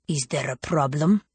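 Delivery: put-on accented guardian stepping in; stern guardian. A narrator's reading. Guardian confronting someone harassing a woman, stern. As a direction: challenge them as a stern guard. Fierce guardian interrupts the women.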